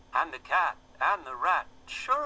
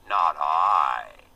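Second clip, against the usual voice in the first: low, loud, snippy pig voice; 7 semitones lower, 9 dB louder than usual. A narrator's reading. The pig turning its back on the hen, low and snippy. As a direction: turn your back and snap it, piggy. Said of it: "with snippy glee".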